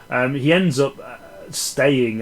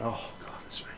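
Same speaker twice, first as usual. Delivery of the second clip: breathy self-mocking whisper under the breath; sheepish whispered confession; whispered aside; 16 dB quieter than usual. Real, not acted. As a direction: let it slip out as a hushed joke at yourself.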